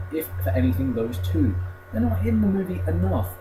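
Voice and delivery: usual speaking voice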